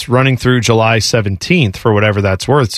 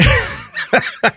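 high breathy laugh; 10 semitones higher, 4 dB quieter than usual. Real, not acted. Laughs at queen joke.

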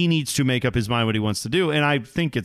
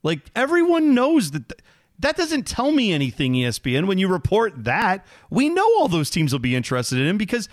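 exasperated rant; 10 semitones higher than usual. Real, not acted. Host ranting to co-host about ESPN, higher exasperated outburst. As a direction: vent at the obvious reporting. Ranting at ESPN's obvious report.

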